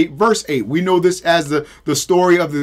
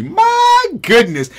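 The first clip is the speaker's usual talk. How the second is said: high exclaim; 12 semitones higher, much slower than usual. Real, not acted. Preacher exclaims mid-quote.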